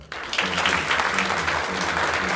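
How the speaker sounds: calling thanks over applause; loud thanks. Real, not acted. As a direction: thank them loudly over the clapping.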